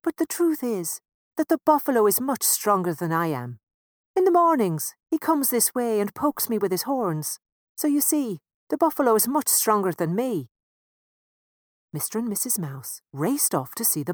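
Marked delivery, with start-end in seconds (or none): 0.0-10.5 higher, louder bashful fence; a lightly voiced, shy fence. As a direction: explain timidly, eager to please. Fence explains the buffalo.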